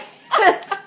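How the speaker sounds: loud high laugh; amused loud laugh. Real, not acted. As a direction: crack up laughing. Laughs at own joke.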